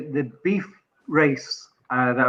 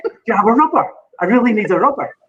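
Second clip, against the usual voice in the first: higher, louder, earnest repeat of a question; retold earnest plea, pitched up; innocent insistence; 7 semitones higher, 4 dB louder than usual. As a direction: press the request, oblivious to the misunderstanding. Retells repeating his question, insisting.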